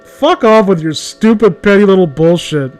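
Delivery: usual speaking voice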